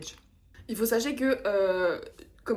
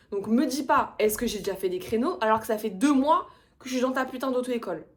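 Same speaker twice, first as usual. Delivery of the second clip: louder, indignant comeback to the instructor; acted angry retort; 2 semitones higher, 5 dB louder than usual. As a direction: tell him off, hitting it harder.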